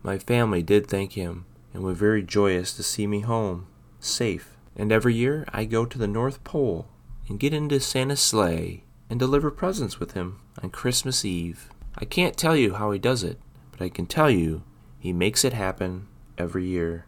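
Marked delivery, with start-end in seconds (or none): none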